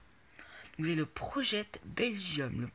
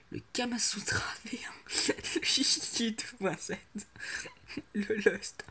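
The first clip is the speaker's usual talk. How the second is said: whispered with a stifled laugh, a little higher; giggly whisper; 5 semitones higher than usual. Real, not acted. Vlogger whispering so his sleeping sister stays asleep. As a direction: hush it and giggle at the silly names. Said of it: "je peux pas parler trop fort".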